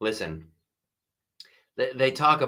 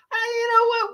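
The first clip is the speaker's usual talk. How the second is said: sudden squeak; 3 dB louder than usual. Real, not acted. Sudden high exclamation.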